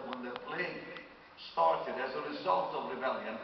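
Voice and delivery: ordinary talk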